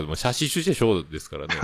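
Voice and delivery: ordinary delivery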